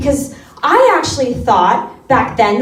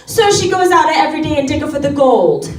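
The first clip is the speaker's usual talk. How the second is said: proud grandma; 4 semitones higher than usual. Retold or imitated. Grandma bragging about her granddaughter to a friend, high and proud. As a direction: brag like a proud nonna. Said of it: "grandma speaking again".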